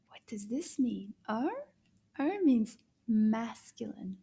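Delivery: whispered question and answer; hushed, voiceless teaching. Shown as a demonstration. Teacher whispers the rule to the children.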